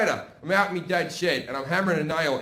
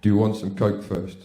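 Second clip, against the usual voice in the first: much lower, deep-voiced big guy; big man's voice; 10 semitones lower than usual. Big man in the cubicle offering coke, deep-voiced. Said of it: "And he went".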